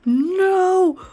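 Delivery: high, loud cries of alarm; real panic, crying out; panicked. Real, not acted. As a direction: player screaming at monsters outside her tiny house. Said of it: "I might be panicking".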